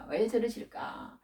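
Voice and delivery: soft, mildly pained lament; acted-out quiet griping; voiced hurt complaint. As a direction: complain gently, as if wounded.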